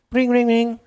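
spoken ring-ring sound effect; imitated phone ring. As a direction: mimic a ringing phone.

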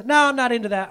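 high, loud mock refusal; dismissive refusal. Jonah turns down God's errand, dismissively. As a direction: blurt out a cheeky no thanks.

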